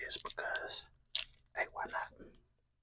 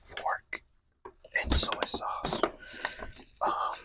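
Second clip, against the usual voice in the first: whispering; 6 dB louder than usual. Real, not acted. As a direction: whisper close to the mic while eating. ASMR mukbang whispered throughout.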